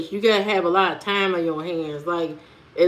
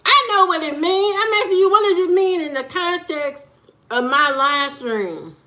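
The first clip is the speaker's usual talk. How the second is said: insistent; 7 semitones higher than usual. Real, not acted. Pressing a chatter for meaning.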